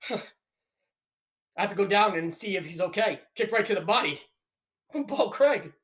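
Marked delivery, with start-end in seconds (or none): 3.3-5.7 play-by-play commentary. Acted. Streamer calls the fight.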